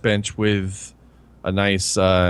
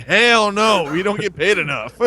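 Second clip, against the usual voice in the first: lightly acted indignant refusal, raised pitch; mock delivery guys; 8 semitones higher than usual. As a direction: refuse as a fed-up delivery guy, hammed-up. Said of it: "The delivery guys are like".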